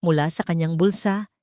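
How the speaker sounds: ordinary delivery, nothing marked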